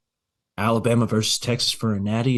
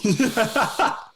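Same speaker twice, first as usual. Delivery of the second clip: high laugh; 10 semitones higher than usual. Real, not acted. Laughs at friend's pick.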